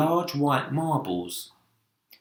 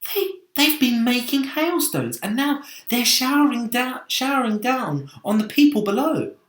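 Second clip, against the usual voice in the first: much higher, bursting with discovery; excited boy; 13 semitones higher, 6 dB louder than usual. Acted. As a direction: rush it out in wonder.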